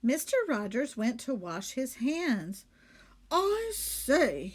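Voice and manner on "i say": calling out. Acted. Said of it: "he called".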